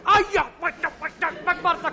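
hammed-up cry of pain, high and loud; pained yelp. Adult poked in the eye by the child, hammed-up pained yelp.

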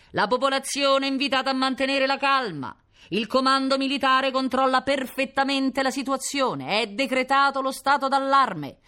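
high, loud, sustained public announcement; booming loudspeaker announcer. A narrator's reading. Police loudspeaker giving orders to residents, booming announcement. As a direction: project orders like a police megaphone. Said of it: "tuonò la voce di un altoparlante".

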